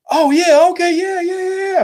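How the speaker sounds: imitating him, voice jumping high and squeaky; hammed-up sudden "oh, okay" at a high pitch; mock excited realization. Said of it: "And he was like".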